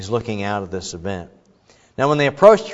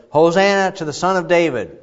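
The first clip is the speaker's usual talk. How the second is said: moderately raised leading call; priest's call; 6 semitones higher, 4 dB louder than usual. Retold or imitated. Preacher voicing the priest leading the people's chant. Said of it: "So the priest would say".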